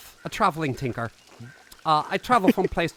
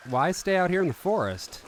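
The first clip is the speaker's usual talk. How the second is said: ordinary delivery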